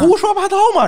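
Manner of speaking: high, loud burst of outrage; indignant outburst. Real, not acted. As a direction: scoff it out, indignant. Outraged at fake Beijing claim.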